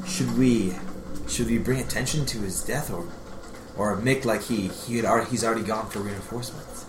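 low, hushed, hesitant aside; under his breath. Acted. Companion privately asking Taladar about covering a death, under his breath. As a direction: murmur it discreetly to a companion. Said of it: "under his breath".

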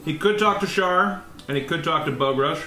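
ordinary delivery

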